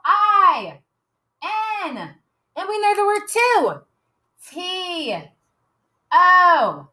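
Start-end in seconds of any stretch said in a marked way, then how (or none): 0.0-2.1 yelled letter. Shown as a demonstration. Teacher leading kids in spelling, yelled out. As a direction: yell out the letter I. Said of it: "yell it out".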